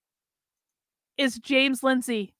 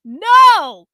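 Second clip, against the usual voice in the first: much louder roar; 5 semitones higher, 12 dB louder than usual. Acted. Carlin roars at James, furious. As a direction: roar it with the blow. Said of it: "Carlin roared".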